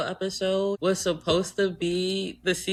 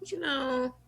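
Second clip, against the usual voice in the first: ordinary talk, nothing marked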